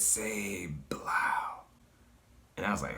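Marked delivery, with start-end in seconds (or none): 0.0-1.6 slow eerie whisper; whispery entity voice. Retold or imitated. Hooded figure demands the name, hushed whisper. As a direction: whisper it like a sinister command. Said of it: "but it said".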